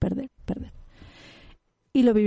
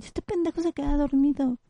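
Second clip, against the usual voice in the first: lightly voiced complaining friend, pitched higher; 6 semitones higher than usual. Friend grumbling about her partner falling asleep, mock complaint. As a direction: imitate a friend griping, in a raised pitch. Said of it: "Cuántas amigas has escuchado que dicen".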